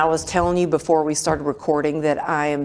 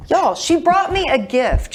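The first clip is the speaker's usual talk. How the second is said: much higher and louder, thrilled; delighted squeal to the audience; excited gush; 10 semitones higher, 4 dB louder than usual. Real, not acted. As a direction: gush to the listeners about the gift. Host gushing about a gift.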